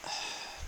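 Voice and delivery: long, soft exhale; weary sigh. Real, not acted. Exasperated by voters, a soft weary sigh. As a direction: let out a quiet, tired breath.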